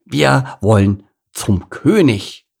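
put-on slow, loud proud child; deliberate, boastful cub voice; swelling pride. A narrator's reading. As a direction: announce it puffed up with pride. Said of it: "mit stolz geschwellter Brust".

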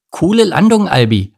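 high, loud, impressed cheer; teasing praise. A narrator's reading. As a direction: tease with mock admiration. Miri neckt Albert nach Bruchlandung. (spöttisch begeistertes Lob)

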